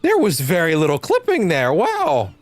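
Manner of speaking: amazed, high and loud; excited. Real, not acted. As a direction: blown away by what he sees. Impressed by clean clipping.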